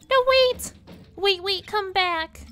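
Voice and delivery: high, loud desperate call. Acted. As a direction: shout after him to come back. Girl calling after warden.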